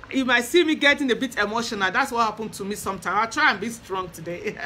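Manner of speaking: ordinary talk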